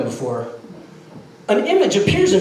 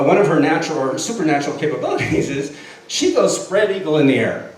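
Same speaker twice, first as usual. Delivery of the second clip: ordinary talk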